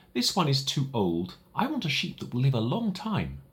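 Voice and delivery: put-on a boy gently refusing; lightly voiced little prince. Little prince rejects drawing.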